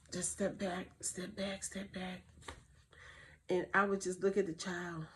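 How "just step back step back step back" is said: calming fade. Shown as a demonstration. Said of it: "take a deep breath".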